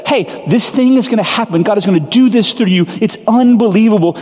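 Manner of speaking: high, eager announcement; excited angel. Retold or imitated. Angel telling Mary the news, excitedly high. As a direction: blurt out the big news with glee. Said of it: "and says".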